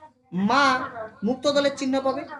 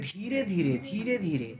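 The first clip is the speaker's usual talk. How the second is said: ধীরে ধীরে said softly and slowly; slow and smooth; 4 dB quieter, slower than usual. Shown as a demonstration. Teacher showing students how slowly to read the poem. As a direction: ease through it romantically. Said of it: "যতটা slow করবি".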